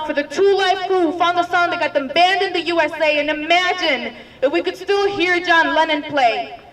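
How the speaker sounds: rousing spoken-word roll call; poem recitation. Acted. Dreamer honouring those who stood up, rousing spoken word.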